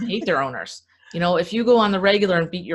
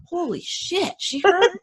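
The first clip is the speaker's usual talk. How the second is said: a horse gasping in much higher pitch; shocked horse; 10 semitones higher, slower than usual. Retold or imitated. Horse startled that she understood, high and amazed. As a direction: squeal it out in disbelief.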